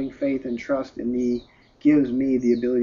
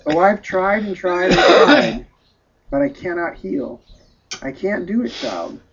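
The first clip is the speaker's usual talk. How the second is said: anguished cry, higher and louder on the repeated attempts; mournful outburst that drops away at the end; 5 semitones higher, 7 dB louder than usual. A narrator's reading. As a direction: cry out in helpless grief, voice rising. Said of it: "by crying mournfully".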